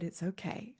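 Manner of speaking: very soft, gentle reassurance; calm her down softly; soothing. Retold or imitated. Healer calms crying visitor.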